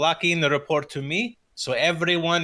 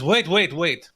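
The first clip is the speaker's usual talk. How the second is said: urgent wait; 5 semitones higher, 6 dB louder, slower than usual. Acted. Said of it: "he says".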